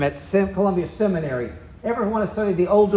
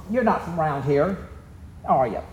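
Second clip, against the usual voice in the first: easy, smiling, neighborly question; friendly Southern question; 3 semitones lower, 4 dB quieter than usual. Shown as a demonstration. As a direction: ask it with a smile, offering help. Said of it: "Friendly, with a smile on my face".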